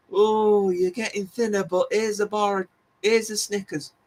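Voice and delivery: playful imitation of a tempter; teasing temptation. Acts out viewers tempting him.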